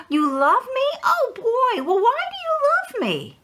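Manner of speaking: high, bouncy puppet squeak of delight; a little puppet overjoyed to hear he's loved; excited puppet voice. Acted. Puppet Happy amazed at parents' love.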